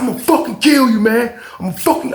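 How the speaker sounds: high-pitched, enraged threat; imitated snapping. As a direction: act out a man losing it and threatening. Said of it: "he's like, he's snapping".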